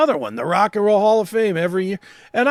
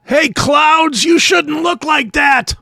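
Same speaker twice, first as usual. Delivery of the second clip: put-on shouting old man, slowing down; old man yelling; 6 semitones higher, 5 dB louder, slower than usual. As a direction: holler at the sky like a cranky geezer. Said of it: "he's yelling at the clouds".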